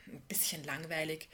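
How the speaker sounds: soft, understated grumble; brummend. A narrator's reading. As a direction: mumble a lukewarm, unimpressed answer. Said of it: "brummte Lisa". (leise und lustlos gebrummt)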